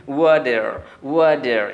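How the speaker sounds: loud "ওয়াটার" as wa-der; American accent. Shown as a demonstration. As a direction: turn the t of "ওয়াটার" into d, sound the r. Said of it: "ট সাউন্ডকে অনেকটা ড করে দেয়".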